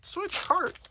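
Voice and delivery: very high-pitched imitation of a game announcement; game player's voice. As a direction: squeal it like a video game voice. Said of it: "it says Switch game pack. It's like".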